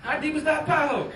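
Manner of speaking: high, loud amazed outcry; shocked. Retold or imitated. Comic retelling the pothole to the crowd, loud astonished cry. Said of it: "and I'm like".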